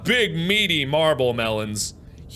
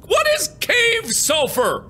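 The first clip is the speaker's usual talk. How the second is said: high, slow bewildered wail; wailing in confusion; frantic exasperation. Real, not acted.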